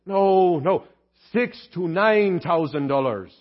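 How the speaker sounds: put-on slow, spelled-out Irish accent; [name]. Deacon clarifying the misheard price, slow Irish accent. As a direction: correct them word by word in an Irish brogue. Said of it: "Your accent's a little thick".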